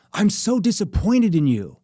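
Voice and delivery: loud, high, slow scolding; loud accusing disappointment; mad at girlfriend. Shown as a demonstration. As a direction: scold her, disappointed and angry. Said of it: "the way I asked it with my tone and my hands".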